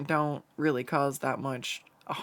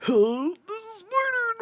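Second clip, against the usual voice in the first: high, thin panicked squeal; mock-panicked squeak, far above the usual voice; freaking out; 4 dB louder than usual. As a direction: squeal it as if scared of a spider. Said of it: "freaking out a little bit".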